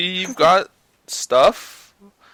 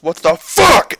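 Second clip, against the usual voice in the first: higher, louder mock outrage; imitated outburst; 5 semitones higher, 8 dB louder, slower than usual. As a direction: retell her losing it, loudly. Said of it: "she fucking loses her shit".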